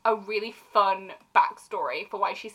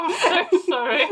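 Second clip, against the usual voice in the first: high, slow words through giggles; laughing apology; 7 semitones higher, 5 dB louder, slower than usual. Real, not acted. Speaker sorry to co-host for the bad accent, laughing. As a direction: apologise while cracking up.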